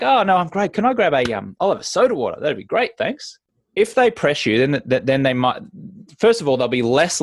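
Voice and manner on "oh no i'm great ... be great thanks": higher, cheerful no thanks; bright upbeat refusal. Retold or imitated. Guest cheerfully asking for soda water instead, higher and upbeat. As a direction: turn down the drink happily. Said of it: "your energy is like".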